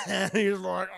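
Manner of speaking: ordinary talk, nothing marked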